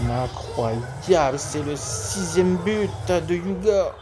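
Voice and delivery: lively sports commentator calling a match; play-by-play commentary. Acted. Live football game commentary. (commentaire sportif en direct)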